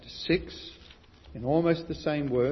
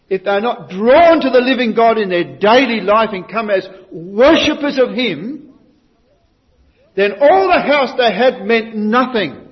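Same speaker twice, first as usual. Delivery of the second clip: loud, higher, driving sermon; fervent preaching; 8 semitones higher, 12 dB louder than usual. Real, not acted. Preacher warning the congregation, loud fervent preaching. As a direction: press it home to the congregation.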